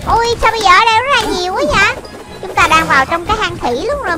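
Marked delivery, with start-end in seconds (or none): none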